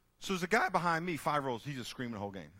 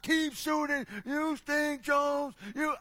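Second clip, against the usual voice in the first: high, loud jeering yell at a missed shot; mock the player from the stands; imitated heckling shout; 8 dB louder, much slower than usual. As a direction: fan jeering Jones after each miss. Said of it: "over and over and over".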